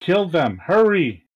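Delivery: urgent high-pitched command; yelling halfling. Acted. Halfling urging the creatures to kill, high yelling. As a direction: raise the pitch and yell it like an order. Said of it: "hear the halfling yell at you".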